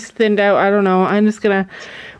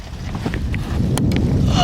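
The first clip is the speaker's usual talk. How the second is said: sharp delighted intake of breath; excited gasp; 3 dB quieter than usual. Real, not acted. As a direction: gasp at the surprise. Sees the sap bags filling.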